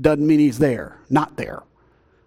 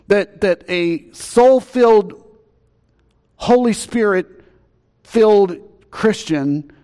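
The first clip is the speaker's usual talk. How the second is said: rising, louder preacher's cry, slowed right down; full-force preaching; 5 semitones higher, 4 dB louder than usual. Acted. Pastor stirring the congregation, preaching loud and slow. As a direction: preach it out, drawing each word long.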